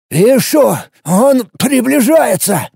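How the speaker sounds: high, slow, rasping shout; hoarse shout. Acted. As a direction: rasp out the warning, straining. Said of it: "прохрипел землянин".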